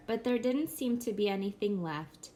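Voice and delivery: ordinary delivery, nothing marked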